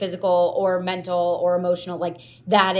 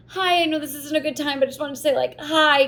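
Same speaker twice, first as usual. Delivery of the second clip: much higher, sing-song imitation of an awkward acquaintance; awkward friend greeting; 9 semitones higher, faster than usual. Friend's friend greeting her while she sobs, high mock voice. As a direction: squeak out an awkward, apologetic hello. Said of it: "and she's like".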